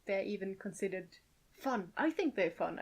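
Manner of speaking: usual speaking voice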